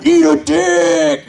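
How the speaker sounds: drawn-out, high-pitched parting yell; mock parting shout. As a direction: holler it slowly as you run off. Acting out storming off stage.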